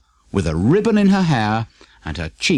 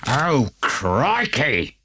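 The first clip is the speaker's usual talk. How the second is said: dismay; much slower than usual. A narrator's reading. Wolf reading letter.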